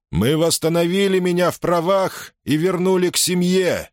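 high, ringing address to troops; roaring rallying speech. Acted. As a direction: proclaim it to the legion. Governor thanks soldiers.

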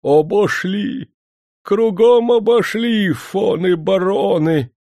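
high, slow wailing lament. A narrator's reading. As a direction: wail it in despair. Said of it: "он заголосил".

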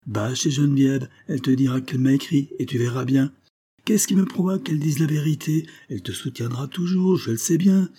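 high-pitched, agitated retorts; heated argument. Acted. Spouses arguing over the burnt letter, agitated.

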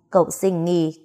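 ordinary delivery

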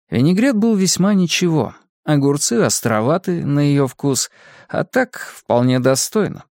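ordinary delivery, nothing marked

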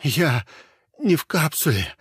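ordinary delivery

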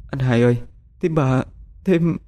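high, broken weeping voice; tearful sobbing. Acted. Young woman tells him his mother died, sobbing. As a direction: choke out bad news through tears. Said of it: "đang ngồi sụt sùi khóc".

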